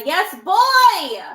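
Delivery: high, loud hype call to the chat; excited call-out. Real, not acted. Host asks the chat for a yes, loud excited call. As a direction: shout it out like a hype man.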